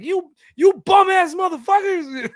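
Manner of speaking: very high, loud comic scream; mock outburst. Translator interrupting the calm president, mock-furious screech. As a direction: jump in as a hammed-up anger translator. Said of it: "jumping in and being like".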